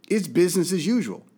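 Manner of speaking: ordinary delivery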